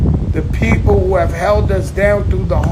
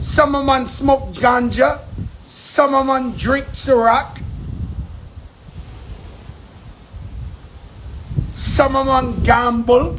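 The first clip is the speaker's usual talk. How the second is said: mock Jamaican patois, loose and laid back. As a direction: play a Caribbean island guy, lightly. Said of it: "I'm just using my patois voice".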